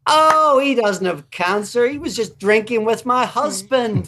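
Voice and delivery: high, loud, laughing dismissal; Sharon dismissing it. Retold or imitated. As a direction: brush off the rumour in a high voice. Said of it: "And Sharon's like".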